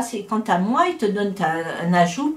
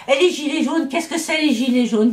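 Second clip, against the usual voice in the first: imitating baffled questioning, higher pitched; mock bewilderment; 6 semitones higher than usual. Dutch puzzled by the French yellow vests, mock bewilderment.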